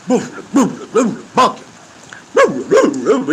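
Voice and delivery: high booming imitation of a horse bucking; bucking horse noises. Telling friends how the prison horse bucked, booming noises. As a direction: punch out each boom like hooves.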